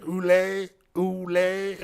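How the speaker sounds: hammed-up French tourist saying "Oolé"; mock French accent. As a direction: imitate a lost Frenchman repeating "Oolé". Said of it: "he was like".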